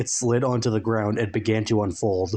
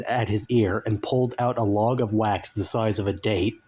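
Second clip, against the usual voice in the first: plain reading voice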